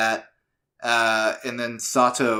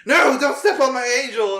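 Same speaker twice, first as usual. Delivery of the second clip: shrieking Mitsuboshi; 12 semitones higher, 4 dB louder than usual. Retold or imitated. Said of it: "Mitsuboshi's immediately like".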